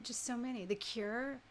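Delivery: usual speaking voice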